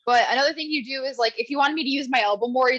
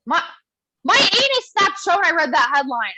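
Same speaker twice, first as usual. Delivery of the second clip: normal talking voice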